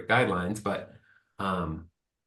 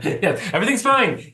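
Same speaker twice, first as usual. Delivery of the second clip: sarcastic cheer; 10 semitones higher, 4 dB louder than usual. Pretend. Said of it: "joking about "fine".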